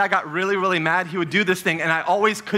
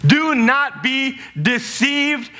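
very slow and deliberate; slow repeat; 3 semitones higher, much slower than usual. Shown as a demonstration. Preacher repeating the verse slowly for the church. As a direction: drag each word out. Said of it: "Etch this into your minds".

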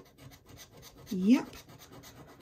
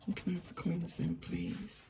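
ordinary talk

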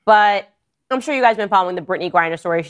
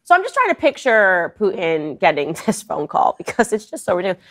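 ordinary delivery

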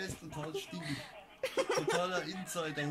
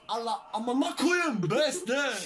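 louder and higher, building excitement; hyped up; 4 semitones higher, 6 dB louder than usual. Real, not acted. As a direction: hype it up like an ad. Hyping the page.

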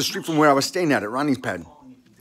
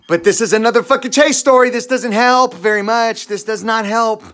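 higher, louder, fed-up complaint; exasperated outburst; 6 semitones higher, 5 dB louder than usual. Real, not acted. Telling viewers about another Chase mess, exasperated and raised. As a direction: throw up your hands at the bank.

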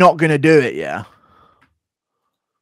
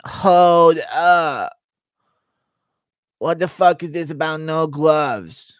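lightly voiced tough fighter, indignant; a hint of a confused brawler; Diaz impression; 2 semitones higher, slower than usual. Fighter balking at a contract, mild indignant drawl. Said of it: "Diaz trying to understand his B K F C contract impression".

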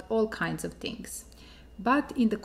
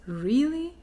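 high, rising, louder surprised Really?; 6 semitones higher, 4 dB louder than usual. Shown as a demonstration. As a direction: ask it in amazed disbelief. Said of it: "as an act of surprise".

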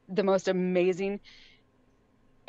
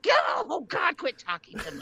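higher, then a yelped dropping "quit talking to me"; embarrassed mom shutting her son down; hammed-up squeamish cry; mock horror; 11 semitones higher than usual. Mom horrified by son's comment.

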